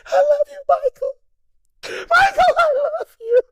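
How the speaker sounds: very high, wailing falsetto sob; hammed-up groupie crying. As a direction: blubber it like an overwhelmed fan. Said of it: "crying like a little groupie bitch".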